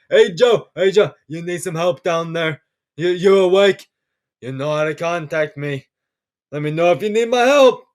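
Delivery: a wobbly mock accent going off the rails; Donald Trump. Imagining tweets taunting Joe, poorly imitated Trump accent. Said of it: "I don't know where my Trump accent is going".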